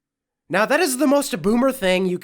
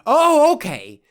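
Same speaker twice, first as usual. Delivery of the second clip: loud, high mock surprise; sarcastic oh okay; 7 semitones higher, 7 dB louder than usual. Real, not acted. Mocking the woman in the video, sarcastic surprise. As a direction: fake being impressed.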